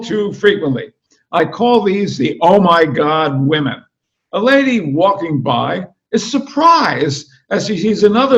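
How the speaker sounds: animated book reading in a dog's voice; story narration. A narrator's reading. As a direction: read it like a comic novel to a crowd. Reads the dog narrator's book aloud.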